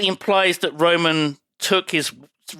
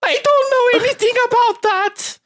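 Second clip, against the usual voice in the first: very high, squeaky child in mock innocence; piping little German lad; little boy voice; 4 dB louder than usual. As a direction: squeal a hammed-up denial. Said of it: "He's like".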